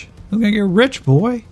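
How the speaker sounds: high sneering echo of "gonna get rich"; mocking repeat. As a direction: taunt him with his own words.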